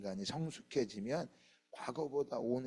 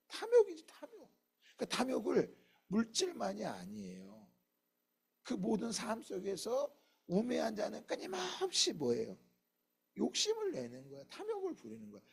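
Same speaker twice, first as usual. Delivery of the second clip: ordinary delivery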